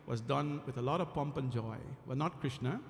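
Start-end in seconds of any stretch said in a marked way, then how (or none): none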